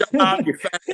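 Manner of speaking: laughing while telling him to shut up; laughing retort. Real, not acted. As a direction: break into a laugh mid-insult. Banter after emotional praise.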